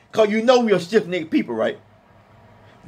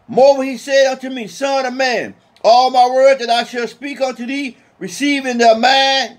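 solemn scripture reading; 3 semitones higher, 3 dB louder than usual. Acted. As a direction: intone the verse with weight. Reads scripture to congregation.

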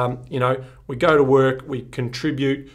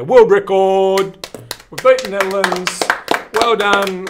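higher, louder triumphant cheer; celebrating; 7 semitones higher, 9 dB louder, slower than usual. Acted. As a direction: cheer it like a commentator at the final siren. Celebrating Australia's record.

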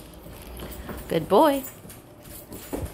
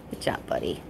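soft breathy whisper of praise; 7 dB quieter than usual. Real, not acted. Trainer whispering praise to her dog during training. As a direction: whisper it so as not to break focus.

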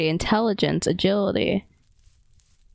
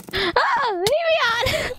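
high panicked scream; slower than usual. Real, not acted. Attacked in game.

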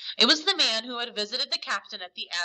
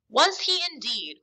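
higher-pitched exclaimed question; raised-pitch reaction to news; cried out; 5 semitones higher, 3 dB louder than usual. A narrator's reading. Silver reacting to the boy's news, crying out. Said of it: "cried Silver".

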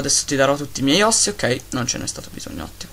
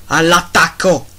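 louder rallying shout; battle cry; 3 semitones higher, 8 dB louder, slower than usual. Acted. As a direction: send the troops charging. Urging wolves to attack.

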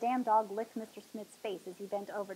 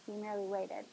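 ordinary delivery

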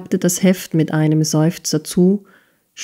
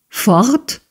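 higher, rising shocked question; startled cry; 5 semitones higher than usual. A narrator's reading. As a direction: blurt it out in alarm. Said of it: "rief Smike und starrte Nicholas erregt". (erschrocken aufrufend, hoch und fragend)

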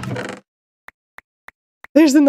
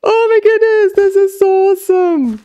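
high, loud whoop of joy; ecstatic cheer; 8 semitones higher, 3 dB louder than usual. Real, not acted. Gamer celebrating a completed hunt, ecstatic cheer.

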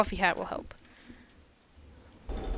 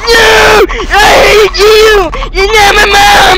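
angry yelling; 10 semitones higher, 13 dB louder, slower than usual. Pretend. Yelling at game character.

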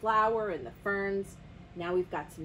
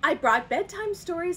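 eager announcement; 8 semitones higher, 7 dB louder than usual. A narrator's reading. Tiger calls to missing host.

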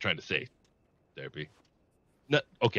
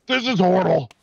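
hammed-up despair; 8 semitones higher, 9 dB louder than usual. Locked in cage, hamming distress.

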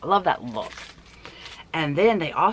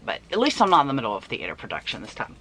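normal talking voice

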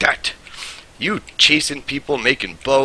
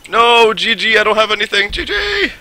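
high panicked cries. Real, not acted. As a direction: cry out no as you lose. Dying in game, crying out.

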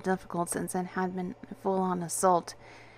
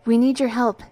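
louder, higher urgent plea; pressing appeal, pitched up; quick, urgent; 5 semitones higher, 10 dB louder than usual. A narrator's reading. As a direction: get it out quickly and forcefully. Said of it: "I said quickly".

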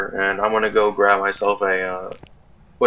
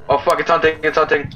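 being chased; 6 semitones higher than usual. Real, not acted. Ghost starts hunting them.

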